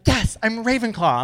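high, proud mock fan voice; lightly mocking smug fan; mocking quote.

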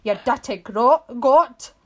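punchy Scottish insult, loud last word; Scottish accent. Acted. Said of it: "had to say that in a Scottish accent".

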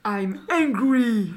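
put-on loud, drawn-out accented English; very slow, overdone English accent; bad English accent. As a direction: belt it in laboured accented English. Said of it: "ton accent anglais de tout à l'heure".

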